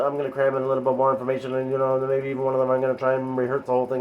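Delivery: fast, crammed delivery. Shown as a demonstration. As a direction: rattle it off at top speed. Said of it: "I'm gonna talk a little bit faster".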